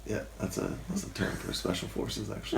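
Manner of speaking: soft unvoiced whisper. Real, not acted. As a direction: whisper it as an aside. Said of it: "whispers after laughter interrupt".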